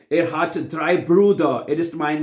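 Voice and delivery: ordinary talk, nothing marked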